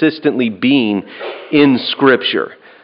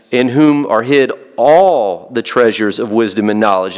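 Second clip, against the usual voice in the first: emphatic, lengthened "all"; stress on "all". Shown as a demonstration. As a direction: lean hard on the word "all", stretching it. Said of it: "I would emphasize the word all".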